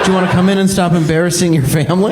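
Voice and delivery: fast mocking put-down; sarcastic dad. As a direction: rattle it off dryly like an unimpressed father. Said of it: "I'd be like".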